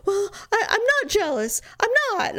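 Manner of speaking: high, sputtering protest; mock defensive denial. Boyfriend denying his jealousy to her, mock defensive. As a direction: imitate him squeaking out a denial.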